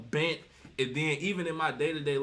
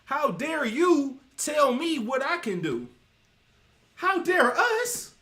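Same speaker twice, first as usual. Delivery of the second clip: mock outrage, higher and louder, slowly drawn out; hammed-up wounded pride, climbing to a loud, high finish; mock indignation; 10 semitones higher, 6 dB louder, slower than usual. Women called out for error answer back, in mock indignation. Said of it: "the pride kicks in and they basically take the stance of".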